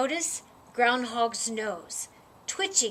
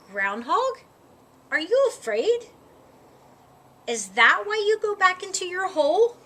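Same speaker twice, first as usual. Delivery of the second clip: calling down; 6 semitones higher, 6 dB louder than usual. A narrator's reading. Moose calling down the hole.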